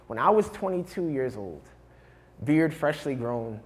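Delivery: high, driving slam-poetry delivery; impassioned spoken-word poem. Acted. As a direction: build into a breathless rush of devotion. Poem at full intensity.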